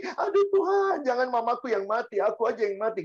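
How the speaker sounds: high-pitched pretend crying plea; mock wailing. Child begging to die instead of mama, mock tearful wail. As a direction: parody a child wailing to God.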